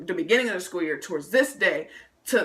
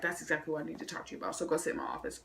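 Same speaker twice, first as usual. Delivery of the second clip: principal; 3 semitones lower, 12 dB quieter, faster than usual. Retold or imitated. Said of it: "He's like".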